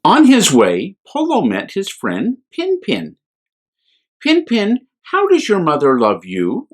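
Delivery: gentle, lively bedtime reading voice; children's story reading. A narrator's reading. A priest reading a picture book to children, gently. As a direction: read it to little ones with wonder.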